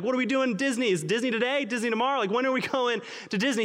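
imitating a small boy begging to go to Disney; excited little boy. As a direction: bounce with a child's impatience. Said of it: "He was so excited".

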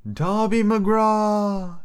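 high, very slow ghostly wail; eerie wailing call. A narrator's reading. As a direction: draw the name out like a voice from nowhere. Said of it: "it railed".

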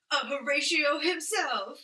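slow, loud, high announcer build-up; announcer intro. Pretend. Host hypes the returning crime drama actor, announcer style. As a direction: present him like a game show host.

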